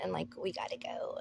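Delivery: whispered, panicky hurry; frightened whisper to her dad; urgent whisper. Retold or imitated. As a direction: hiss it like he might hear you. Freaked-out daughter urging dad.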